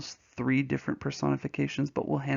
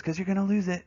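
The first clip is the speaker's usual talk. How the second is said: imitated wife; 6 semitones higher, faster than usual. Voices wife answering him.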